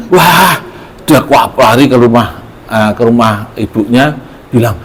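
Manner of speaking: ordinary delivery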